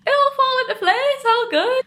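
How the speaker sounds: high, loud and giddy with relief; elated relief. Real, not acted. Vlogger giddy after her housing problem is solved. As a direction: let it all spill out happily.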